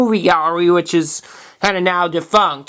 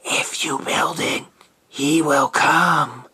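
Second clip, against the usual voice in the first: hushed, slow movie-quote whisper; low, drawn-out imitated whisper; 5 semitones lower, 4 dB quieter, slower than usual. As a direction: whisper it slowly, like a mysterious voice.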